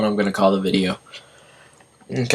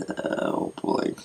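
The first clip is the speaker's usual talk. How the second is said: whispered; 14 dB quieter than usual. Real, not acted. Sighing before more eating.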